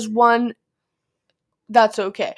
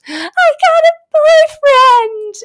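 much higher, louder and slow, a cartoonish mocking quote; mock Jaden voice; 9 dB louder, slower than usual. Retelling how the crush announced a boyfriend, mocking high voice. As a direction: ham up the crush's cheery news in a silly high voice. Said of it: "Jaden just goes".

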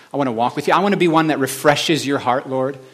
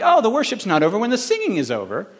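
a lightly raised, eager realization; 7 semitones higher than usual. Retold or imitated. Himself realizing during worship, lightly raised. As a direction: catch the truth mid-worship. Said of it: "and I'm like".

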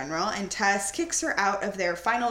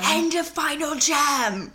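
mock dramatic; 6 semitones higher, 5 dB louder than usual.

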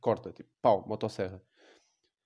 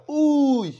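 high loud yelp; mock startled yelp; 11 dB louder than usual. Guardian angel fleeing, yelping in fright. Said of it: "o gajo".

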